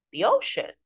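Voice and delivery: put-on high, puzzled echo from an older fish; questioning older fish. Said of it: "says the older fish".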